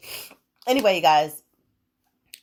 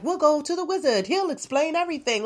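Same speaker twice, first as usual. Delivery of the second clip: eager townsfolk; 9 semitones higher than usual. Acted. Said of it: "They're like".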